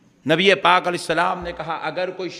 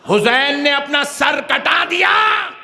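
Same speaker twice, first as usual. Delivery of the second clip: slow, high, loud proclamation; impassioned cry; 7 semitones higher, 6 dB louder, slower than usual. Real, not acted. Preacher proclaiming Husain's sacrifice to the faithful, impassioned cry. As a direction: cry it out, stretching the words. Climax of sermon on martyrdom.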